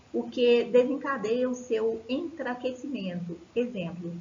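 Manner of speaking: ordinary delivery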